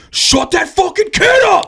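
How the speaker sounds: very high, drawn-out mock scream; imitated angry heckler. Guy in the back about a noisy kid, imitated angry shout. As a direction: yell like an exasperated moviegoer from the back. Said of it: "in the back just goes".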